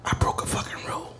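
soft unvoiced whisper aside; whispered confession. Real, not acted. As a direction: let the audience in on it, breathless hush.

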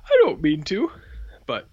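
hiccuping between words; with hiccups. Real, not acted. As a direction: talk through the hiccups. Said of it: "I got the hiccups here for a second".

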